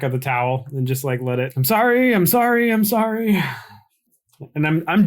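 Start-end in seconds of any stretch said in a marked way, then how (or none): 1.6-3.4 high, repeated apologies; frantic apology. Pretend. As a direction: plead sorry in a raised, higher voice. Voicing apology while pouring antiseptic.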